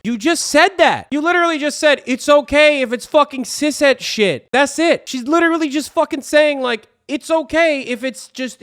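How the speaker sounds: loud, high-pitched indignant rant; exasperated shouting. Real, not acted. Exasperated at the clip's contradiction.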